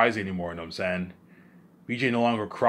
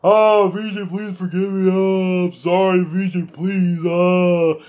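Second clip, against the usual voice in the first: high, loud mock wailing; crying imitation; 7 semitones higher, 6 dB louder, slower than usual. Imitating family begging forgiveness, hammed-up crying. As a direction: beg in a drawn-out put-on wail. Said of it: "They're gonna cry heavy".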